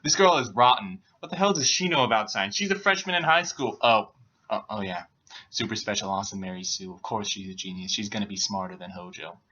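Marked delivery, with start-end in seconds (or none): 3.8-9.4 low, quiet, resigned aside; deadpan sarcasm. Real, not acted. Reader resigned to a perfect heroine, deadpan sarcasm. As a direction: drop low and soft, dryly sarcastic.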